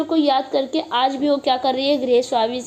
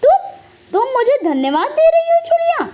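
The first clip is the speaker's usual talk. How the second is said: much higher, louder furious shout; angry shout; 12 semitones higher, 4 dB louder than usual. Acted. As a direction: yell at her in disbelief. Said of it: "तेज आवाज़ में उससे चिल्लाते हुए कहता है". (ऊँची आवाज़ में गुस्से से चिल्लाना)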